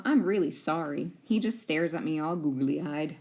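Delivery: plain reading voice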